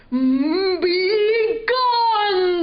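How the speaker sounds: a cranky dragon growling at an intruder. A narrator's reading. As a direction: puff out a gruff dragon threat. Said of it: "the dragon puffed and growled".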